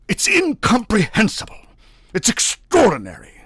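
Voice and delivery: high, loud astonishment; baffled. A narrator's reading. Navagin baffled by the signature, loudly astonished. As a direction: marvel out loud. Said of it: "mused Navagin".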